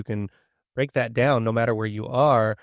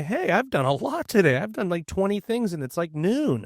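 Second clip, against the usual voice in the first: much higher, buoyant and pleased with yourself; pumped self-praise; 6 semitones higher than usual. Pretend. Teacher acting out a student crediting herself, excited. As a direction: cheer yourself on like you're crushing the day.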